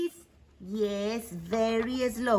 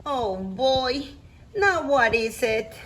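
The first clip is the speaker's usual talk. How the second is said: ordinary talk, nothing marked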